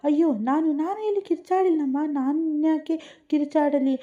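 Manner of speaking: high, alarmed denial, slowing at the end; flustered and caught out, voice jumping up; startled, frightened protest; panicked. A narrator's reading. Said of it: "ದಿಢೀರಂತ ಗಾಬರಿಯಾಗಿ".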